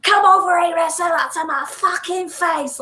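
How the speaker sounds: high-pitched hammed-up Cockney aggro; mock Cockney challenge. Player mimics game's street women picking fights, mock Cockney.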